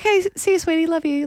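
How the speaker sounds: high, sing-song "see you, sweetie"; mock sweet phone voice; sweet phone goodbye. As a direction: coo a lovey-dovey goodbye. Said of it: "You're like".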